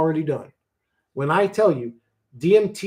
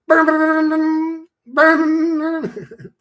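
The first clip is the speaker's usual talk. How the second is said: mock high burn; 6 dB louder, much slower than usual. Mock burning sound at joke end.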